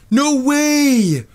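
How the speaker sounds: high, breathless fake disbelief; mock amazement. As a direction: oversell the shock.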